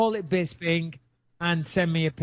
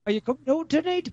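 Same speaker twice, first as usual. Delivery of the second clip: high-pitched mock Geordie; Geordie accent; 10 semitones higher, faster than usual. Host trying the taught phrase, exaggerated Geordie accent. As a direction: pitch it right up in a Newcastle accent. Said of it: "you're doing a Geordie accent".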